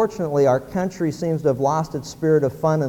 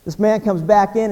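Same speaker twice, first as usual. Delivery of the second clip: ordinary delivery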